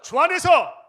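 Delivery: loud, high slogan shout; rally slogan. Acted. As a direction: lead the crowd in a battle cry.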